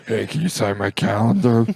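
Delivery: mimicked ugly customer. Ugly guy asks waitress for signature, mimicking voice.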